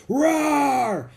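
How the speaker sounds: loud high hammed-up roar; gorilla roar. Jack scaring off the leopard, loud hammed-up roar. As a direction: roar like a silverback. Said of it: "he roared".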